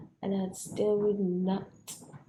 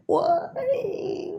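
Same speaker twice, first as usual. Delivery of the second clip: crying why; 13 semitones higher, 3 dB louder than usual. Real, not acted. Crying out after rant.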